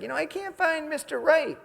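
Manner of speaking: high, whiny mock of a single woman; high mocking girly whine. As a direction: imitate a lovesick woman sighing for Mister Right. Mocking single women's complaint.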